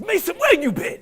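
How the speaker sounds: high, loud demanding holler; barking coach. Retold or imitated. Coach to athlete turning up after practice, shouted demand. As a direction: call him out like an impatient coach.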